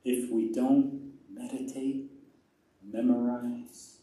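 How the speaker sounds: slow, low and hushed; quiet, grave closing appeal; solemn exhortation. Real, not acted. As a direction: let each word land with long pauses.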